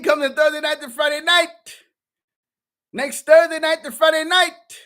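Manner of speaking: high, loud ringing proclamation; preaching proclamation. Acted. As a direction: call it out like a herald to the congregation. Proclaims the holy day.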